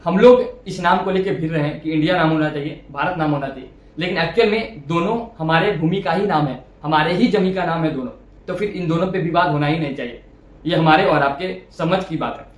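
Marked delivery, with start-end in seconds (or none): none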